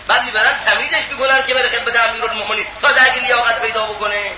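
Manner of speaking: ordinary talk, nothing marked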